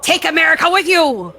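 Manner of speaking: high, loud patriotic exclamation; rallying cry. Acted. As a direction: proclaim it like a rallying call. Climax of patriotic address read aloud.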